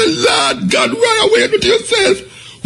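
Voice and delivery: very high, slow cry of disbelief; shocked shriek. Retold or imitated. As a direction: shriek it like you can't believe your eyes. Cousin shrieking again, retold.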